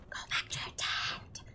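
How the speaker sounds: eerie breathy whisper aimed at the player; creepy whisper. Pretend. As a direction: hiss it like a ghost telling you to leave. Mocking the game's creepy message.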